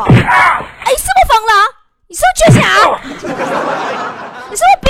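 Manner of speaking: high, loud hammed-up shout; mock furious scolding. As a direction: scream the accusations at a messy boyfriend. Retold furious scolding of boyfriend. (夸张地尖声怒吼训人)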